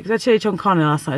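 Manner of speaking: ordinary talk, nothing marked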